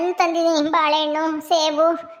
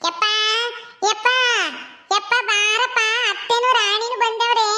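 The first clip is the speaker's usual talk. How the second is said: high, drawn-out call to father; long, high summoning call; 5 semitones higher than usual. Acted. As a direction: call him over from a distance. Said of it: "ಇರು, ಕರಿತೀನಿ".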